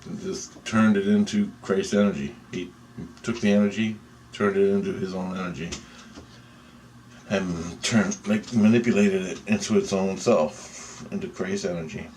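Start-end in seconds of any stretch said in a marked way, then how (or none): none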